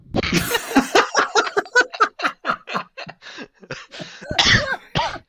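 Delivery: high, loud cackling laughter; helpless extended laugh; long loud laughing fit. Real, not acted. Laughs at own joke answer.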